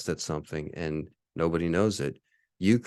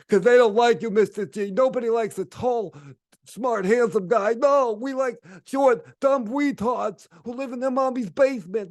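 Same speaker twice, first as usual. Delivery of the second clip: high, loud, sneering put-on voice; higher mock-hater voice taunting Mister G; 4 dB louder than usual. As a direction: parody the haters in a high whiny voice. Mocking what haters say.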